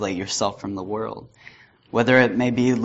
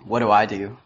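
normal talking voice

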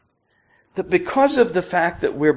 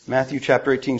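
ordinary talk

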